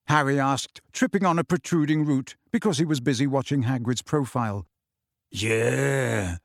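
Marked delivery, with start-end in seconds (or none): 5.3-6.4 drawn-out, weighty admission; heavy. A narrator's reading. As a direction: let it out with a heavy heart. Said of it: "said Hagrid heavily".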